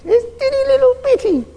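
put-on high, squeaky voice for something teeny; tiny squeaky voice. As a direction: make it sound as small as the seed. Describing tiny mustard seed.

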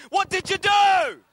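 loud, slow group shout; crowd shout. Retold or imitated. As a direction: bellow the question together. Seven people chorus the question.

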